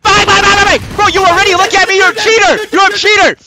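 high, loud frantic yelling; shouted accusation. Real, not acted. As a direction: yell "cheater" at the opponent. Duel broken, accuses cheating.

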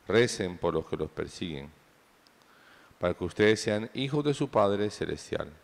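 solemn liturgical proclamation; gospel reading. Acted. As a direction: read it as scripture from the pulpit. (proclamación solemne del evangelio)

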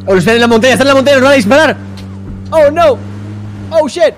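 high, loud frantic shouting; panicked yelling. Real, not acted. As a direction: scream warnings in panic. Enemies on mountain, panic.

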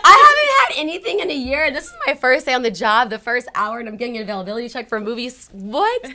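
reliving the shock of good news; excited disbelief. Retold or imitated. Reliving disbelief at the movie check.

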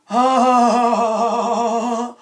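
long rattling laugh; mocking laugh.

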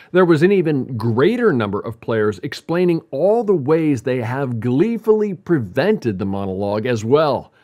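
normal talking voice

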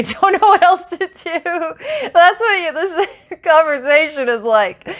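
high, squeaky real laughter through the words; laughing. Real, not acted. Likening this conversation to a yelling parent, laughing hard. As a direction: crack up mid-sentence, voice shooting up. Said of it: "I'm laughing 'cause I need a breather".

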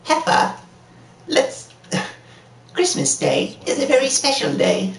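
high, drawn-out cartoon character voice; slow it down into a high-pitched cartoon voice; Rocko impression. Showing a friend the cartoon impression, high cartoon voice. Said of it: "my Rocko's Modern Life impression".

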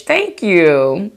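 ordinary talk, nothing marked

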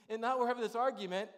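normal talking voice

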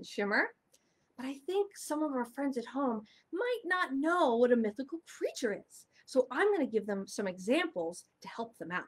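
usual speaking voice

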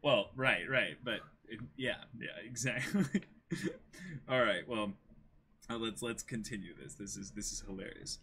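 usual speaking voice

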